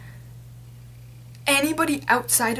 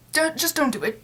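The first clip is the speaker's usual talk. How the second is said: ordinary talk, nothing marked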